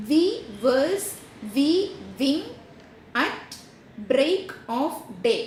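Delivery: "We verse, we wing at break of day" read very slowly with a pause after each syllable. Shown as a demonstration. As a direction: space out every beat of the verse line, very slow. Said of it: "അതിൻ്റെ ഉദാഹരണം ഞാൻ പറഞ്ഞു തരാം". (ഓരോ സിലബിളും നിർത്തി വളരെ പതുക്കെ)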